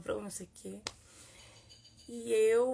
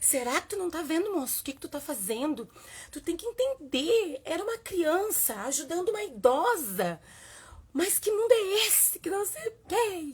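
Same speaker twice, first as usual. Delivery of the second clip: higher, louder, self-righteous scolding; mocking accusation; 7 semitones higher, 5 dB louder than usual. Mother lecturing the man who yelled, high and scolding. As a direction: play up how preachy you sounded. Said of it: "Como só ele tivesse fazendo alguma coisa errada".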